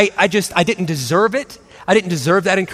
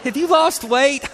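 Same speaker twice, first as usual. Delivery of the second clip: fake sweet church greeting; 8 semitones higher than usual. Mom turning fake-nice when church friends walk by. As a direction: switch from hissed threat to beaming politeness. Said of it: "and I'm like".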